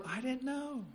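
small, high, soft voice; sheepish excuse. Acted. People caught off guard talk to the Lord, meek high excuse.

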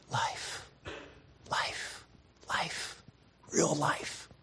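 soft whispered repetition; hushed echo after the shouted climax. Acted. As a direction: breathe the words out.

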